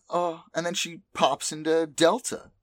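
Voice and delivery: normal talking voice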